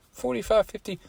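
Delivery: high, soft, unsure answer; tentative guess. Retold or imitated.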